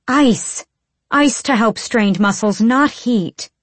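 sharp, high exasperated scolding; angry. Acted. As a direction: snap at him in frustration. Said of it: "Irene swore viciously".

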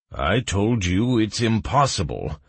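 irritable. A narrator's reading. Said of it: "irritably scratching his eyebrow".

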